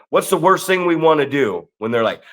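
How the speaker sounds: usual speaking voice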